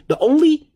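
"only" punched high and loud; emphatic "only". Real, not acted. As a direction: lean hard on "The only". Stressing only common stock.

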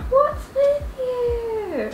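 high, drawn-out question; cooing at pet. Real, not acted. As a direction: stretch it out high at the cat. Exasperated question to her cat.

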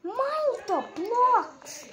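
slow high whining stretch; drawn-out pleading. Real, not acted. Player begging the game, slow high whine. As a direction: stretch every word in frustration.

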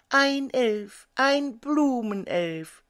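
high, drawn-out cry of bees; excited calling. A narrator's reading. Bees greeting the flower elf, calling out high. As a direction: call out high, stretching the last word. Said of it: "riefen sie".

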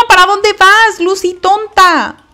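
high, loud scolding calls; frantic calling out. Real, not acted. Player yells at Sandy the dog to go inside. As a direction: yell at the dog to get back inside.

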